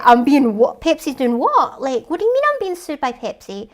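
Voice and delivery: loud, high, stunned retort; can't believe what he hears; shocked disbelief. Retold or imitated. John to the reporter, loud and shocked. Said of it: "And John's like".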